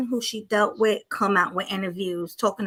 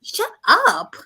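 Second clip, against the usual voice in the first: higher, louder, exasperated cut-off; exasperated outburst; 11 semitones higher, 6 dB louder than usual. Real, not acted. Co-host fed up with the woman in the clip, exasperated. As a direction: tell her off, loud and high.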